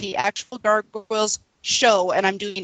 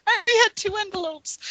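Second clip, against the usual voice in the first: mock panic; 12 semitones higher than usual. Cohost joins the mock panic.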